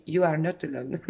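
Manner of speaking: put-on English with a playful French accent; self-mocking French-accented English. Reassuring the viewer in English, playfully French-accented. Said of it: "avec mon super accent".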